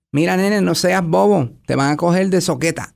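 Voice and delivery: Puerto Rican Spanish, scolding mother; Puerto Rican accent. Retold or imitated. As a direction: warn him like a Puerto Rican mom. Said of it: "My mother would say".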